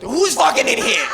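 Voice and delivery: hammed-up indignant roommate; roommate barging in. Roommate walking in on them, comic outburst. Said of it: "Have my roommate come in".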